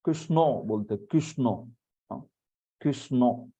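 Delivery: कृष्णो ending in a rounded o; Bengali-style Krishno. Shown as a demonstration. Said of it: "Bengali में जाओगे तो".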